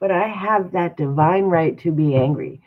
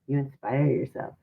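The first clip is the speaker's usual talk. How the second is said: usual speaking voice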